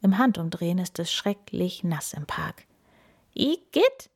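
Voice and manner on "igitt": high, loud "Igitt"; disgusted cry. A narrator's reading. Kim the pony stepping in mud, high loud disgust. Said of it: "ruft Kim".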